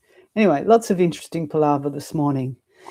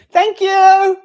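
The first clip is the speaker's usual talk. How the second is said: much higher and louder thank you; teacher thanks departing assistant, loud high call; 10 dB louder than usual. Real, not acted. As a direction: call your thanks after her. Calling thanks again.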